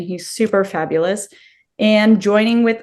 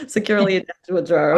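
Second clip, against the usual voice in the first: 1 laughing echo; much faster than usual. Real, not acted.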